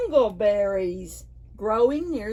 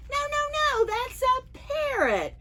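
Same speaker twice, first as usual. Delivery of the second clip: put-on much higher voice, a child monkey saying no; little monkey protesting. Storyteller to children, as the monkey, high protest.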